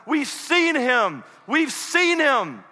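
high, loud, drawn-out joyful cry; slow, ringing exclamation; excited. Acted. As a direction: call out the news excitedly. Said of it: "come running up excitedly".

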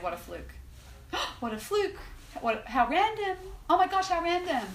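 mock the "how random" shrug; mock dismissive. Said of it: "looked at that moment and said".

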